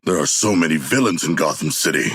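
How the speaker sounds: ordinary delivery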